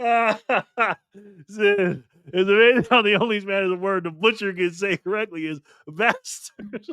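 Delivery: high, slow start through real laughter; laughing while reading. Real, not acted. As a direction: fight the giggles to finish reading. Reading chat comment while cracking up.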